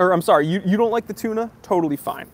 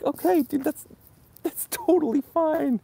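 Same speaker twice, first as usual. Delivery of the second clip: easygoing acceptance; 12 semitones higher than usual. Retold or imitated. Reviewer answering someone who trashed his Rolex, high and agreeable. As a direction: let it slide in a much higher voice.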